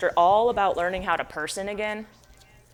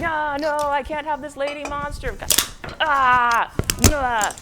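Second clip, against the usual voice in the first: frantic; 7 semitones higher, 4 dB louder, slower than usual. Acted. Victor tearing up lady monster.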